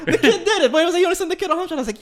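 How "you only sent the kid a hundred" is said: high, loud mock outrage; comically scandalized telling-off; retold indignant scolding. Retells scolding the influencer.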